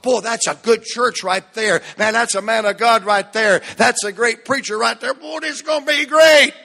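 loud, high, thrilled praise building to a shout; excited churchgoers. Retold or imitated. Congregation members thrilled by the new preacher, shouting praise. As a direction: gush like a thrilled newcomer.

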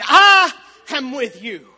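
loud and slow, every word hammered; booming proclamation. Acted. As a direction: declare it as God's own voice. Quoting God at the climax.

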